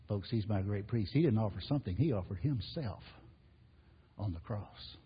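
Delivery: ordinary talk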